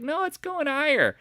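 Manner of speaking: mocking the stubborn bulls in falsetto; mocking high voice. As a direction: mimic the crowd in a silly squeak. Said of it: "Everyone's like".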